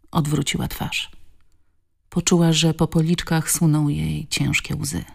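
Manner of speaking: usual speaking voice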